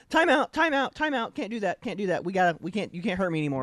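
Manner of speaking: mock-frantic timeout call, pitched high at first then easing down; high, hurried protest of a kid backing out; pleading little brother. Little brother begging big brother for a timeout, mock pleading.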